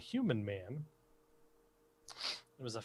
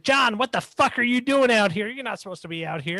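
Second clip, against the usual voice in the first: put-on high, loud scolding old lady; yelling old woman; 11 semitones higher, 7 dB louder than usual. Old woman scolding husband John outside, high loud yell. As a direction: tear into him like an angry old wife. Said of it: "while she's yelling at me".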